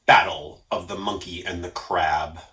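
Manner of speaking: usual speaking voice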